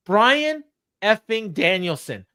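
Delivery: Bryan effing Danielson, slow and punched; emphatic. Real, not acted. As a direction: stress every word of Bryan effing Danielson. Emphatic disbelief.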